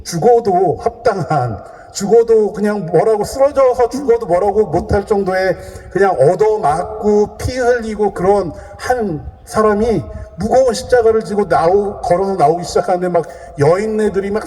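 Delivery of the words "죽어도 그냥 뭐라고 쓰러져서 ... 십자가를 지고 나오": ordinary talk, nothing marked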